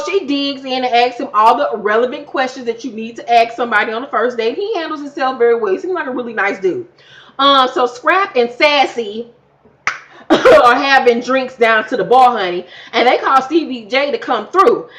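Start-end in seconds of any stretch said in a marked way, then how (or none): none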